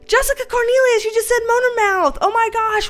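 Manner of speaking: high, loud excited call to a viewer; light up at the comment. Real, not acted.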